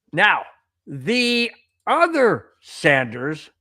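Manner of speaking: slow, drawn-out segment intro; mock-grand radio announcement; show host announcing. Real, not acted. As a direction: stretch the words to build to the reveal.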